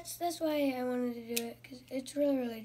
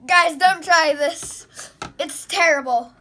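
loud warning; 3 semitones higher, 12 dB louder than usual. Real, not acted. Warning after gross juice.

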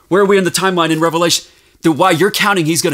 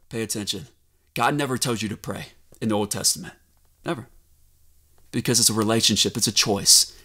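hushed aside; 11 semitones lower, 10 dB quieter, faster than usual. Real, not acted. Sermon drops to hush.

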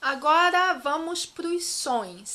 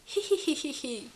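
demonstrated hee-hee titter; small put-on "hihihi" snicker; quiet giggle. Shown as a demonstration. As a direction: titter lightly under control. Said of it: "você tá rindo baixinho".

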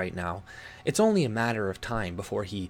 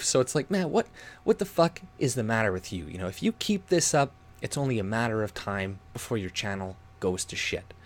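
ordinary talk

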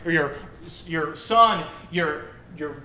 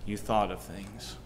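loud, dramatic book reading; reading aloud; 2 semitones lower, 6 dB louder than usual. Shown as a demonstration. Pastor reading Lemony Snicket to the church, loud and dramatic. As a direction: read the passage out with weight. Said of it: "I like this verse".

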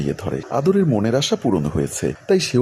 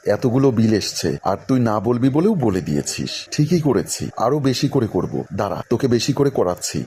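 ordinary delivery

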